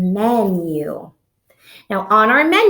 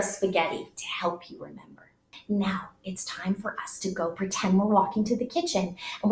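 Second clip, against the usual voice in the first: hushed whisper; 2 semitones lower, 9 dB quieter than usual. Real, not acted. Teacher drops to hush.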